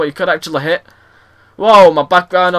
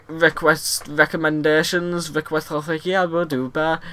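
much softer, slowed muttering; fumbling mumble; 10 dB quieter than usual. Real, not acted. Gamer muddling his sign-off to viewers, quiet fumbling mumble. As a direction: lose the thread and mumble quietly.

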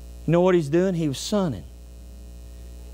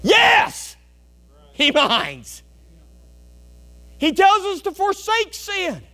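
a sudden, much higher, louder yelled Yes; excited shout; 13 semitones higher, 5 dB louder, slower than usual. Acted. Preacher answering his own question, excited shout. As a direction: burst out with it like a revival cry. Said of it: "with excitement".

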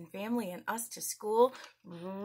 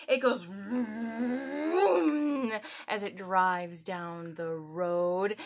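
drawn-out car talk; slower than usual. Acted. Imitating a car for kids.

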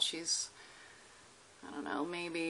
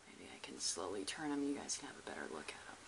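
fast hushed whisper; 12 semitones lower, 12 dB quieter, faster than usual. Real, not acted. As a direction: whisper so the lizard stays calm. Whispering to avoid startling.

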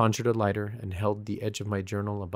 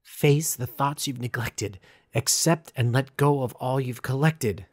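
higher, slower bitter recitation; spat mockingly; 5 semitones higher, 5 dB louder than usual. A narrator's reading. Quoting the ritual back at the statue, spat bitterly. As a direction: spit the ritual words back. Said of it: "I spat".

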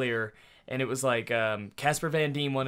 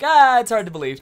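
mock exasperated; 9 semitones higher, 9 dB louder than usual. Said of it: "I'm just like".

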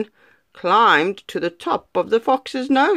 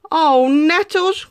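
goat crying out; 9 semitones higher, 3 dB louder, slower than usual. Acted. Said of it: "cried the goat".

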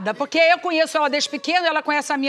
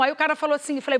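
ordinary talk

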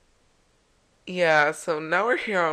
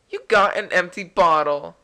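item read out loudly at usual pitch; loud announcement; 9 dB louder than usual. Acted. Player reading out the empty bottle reward, loud announcement. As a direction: announce the prize, full voice.

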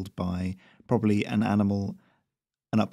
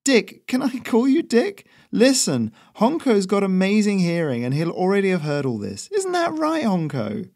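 higher, easygoing character voice for the courier; breezy courier voice; 10 semitones higher, 4 dB louder than usual. A narrator's reading. Courier smoothing things over with the new local, higher breezy voice. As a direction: raise the pitch and play it chummy and casual.

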